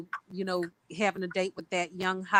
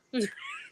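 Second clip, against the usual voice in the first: high squealing laugh; 8 dB quieter than usual. Real, not acted. Joking about being poor.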